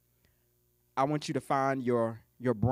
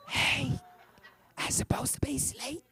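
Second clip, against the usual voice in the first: put-on hushed, high teen phone whisper; 8 semitones higher than usual. High schooler on the line late at night, whispering. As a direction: whisper as if mama might hear. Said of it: "real good at whispering in high school".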